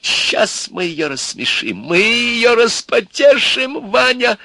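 building to a high, loud crow; gleeful anticipation. Acted. Old man promises to amuse the princess, gleeful. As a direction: crow with mounting relish.

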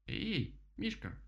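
высокий птичий окрик, не во весь голос; окликни высоко, голосом дятла; крик дятла. A narrator's reading. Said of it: "закричал дятел". (изображая крик дятла)

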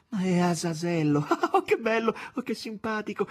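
high and loud, laughing with joy; delighted laughing exclamation. A narrator's reading. Margherita greets Azazello at the door, laughing delightedly. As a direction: burst out laughing at a welcome guest. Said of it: "esclamò".

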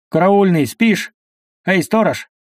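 loud, high calls across the square; shouting. Acted. People by the sledges shout, loud and high, for the watchman. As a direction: holler for the sleeping watchman. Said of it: "закричали люди у саней".

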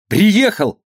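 put-on high boyish calling; shout from afar. Boy runs home with the news, shouting from afar. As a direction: call it out from a distance. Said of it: "кричал он издали".

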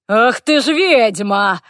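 loud, slow gleeful orc yell; orc bellow. A narrator's reading. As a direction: bellow it out in triumph. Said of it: "Вопль орка был до того громкий".

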